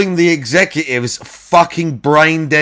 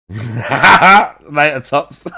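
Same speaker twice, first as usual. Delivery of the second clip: laughing; 3 semitones higher, much slower than usual. Real, not acted. Laughs repeating the name.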